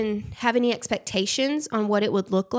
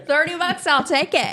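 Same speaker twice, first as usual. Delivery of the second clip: high, laughing retold eagerness; mock eagerness; 6 semitones higher, 4 dB louder than usual. Creator accepting thirty bucks, mock eagerness. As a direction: jump at the offer, playfully.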